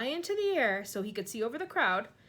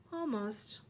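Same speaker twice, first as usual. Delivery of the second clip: sad; 3 semitones higher than usual. A narrator's reading. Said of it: "ghost sadly as he drifted away".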